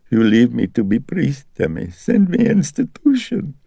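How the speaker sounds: put-on high, pleading old mother's voice. A narrator's reading. Mother pleading with her son, high and sorrowful. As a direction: beg him like a hurt old mother.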